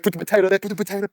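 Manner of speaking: high, loud, urgent schoolkid instructions; urgent coaching. Retold or imitated. Kids in the playground crowd yelling at the player, high and urgent. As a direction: urge the player on in a panic.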